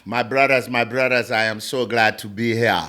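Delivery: put-on deep African accent, measured greeting. Guest playfully greeting his brothers on the podcast, African accent. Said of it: "so now it's the African".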